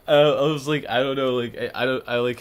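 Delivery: usual speaking voice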